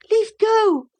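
put-on very high-pitched boy's protest. A narrator's reading. Boy held by the ear, protesting. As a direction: pipe up as an indignant small boy.

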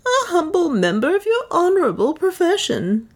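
unruffled, politely ironic; cool. A narrator's reading. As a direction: Leonard coolly introducing himself to the slavers. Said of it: "said Leonard coolly".

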